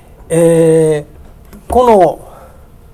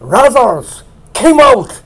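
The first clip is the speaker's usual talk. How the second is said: high, loud English cry; loud call in English; 6 semitones higher, 5 dB louder, slower than usual. Retold or imitated. Preacher voicing Jesus in English, loud call. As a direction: shout the English words out to the tomb. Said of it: "大きな声で叫ばれました".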